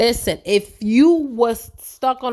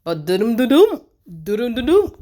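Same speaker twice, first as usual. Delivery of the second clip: sung suspense da-dum, peaking louder; dramatic drumroll sound; 4 dB louder than usual. Pretend. Recapping the show, she hums a suspense sting, mock dramatic.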